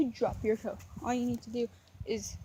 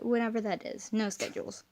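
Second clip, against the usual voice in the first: normal talking voice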